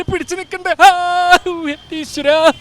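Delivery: loud, high comic howl; hammed-up exclamation in a put-on voice; mimicry voice. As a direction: wail it like a stage mimic. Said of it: "Mahabali mimicry മാത്രമല്ല പലതും കാണിക്കും".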